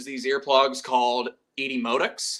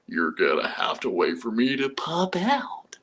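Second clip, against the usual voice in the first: slow, breathy threat at the end; menacing whisper; 6 semitones lower than usual. Retold or imitated. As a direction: Roth hisses the final threat down the phone, whispered. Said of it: "quoting verbatim, uh, Michael Douglas's lines".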